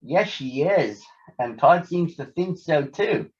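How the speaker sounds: usual speaking voice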